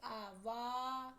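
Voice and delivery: आ and वा drawn out very slowly, vowel held long; stretched syllable. Shown as a demonstration. Teacher stretching वा to show its vowel to students, very slow. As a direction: hold the आ in वा so students hear the vowel. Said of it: "वा के साथ जो भी शब्द निकल रहा है".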